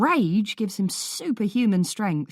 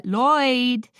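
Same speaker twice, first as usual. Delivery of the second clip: shout; 7 semitones higher, 6 dB louder than usual. A narrator's reading. Calling out to a kicking boy.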